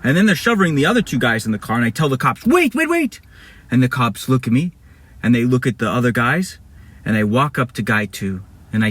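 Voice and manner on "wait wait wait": high, rapid-fire interruption; urgent calling out. Acted. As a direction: call high to stop them. Calling out to stop the cops.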